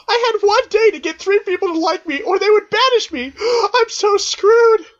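very high, loud wail; panicked character. Acted. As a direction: gasp and cry out in high-pitched panic.

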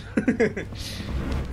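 airy high giggle; a breathy relieved laugh; breathless laugh. Real, not acted. After running from the monster, breathless laugh.

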